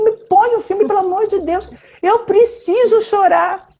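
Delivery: high mock plea, slowing on the last words; mock pleading. Telling daughter to put the movie on, high pleading. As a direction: beg playfully for the sad movie.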